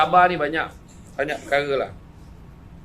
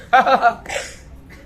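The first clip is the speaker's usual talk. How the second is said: loud cackle; 7 semitones higher, 6 dB louder than usual. Retold or imitated. Imitating the laugh.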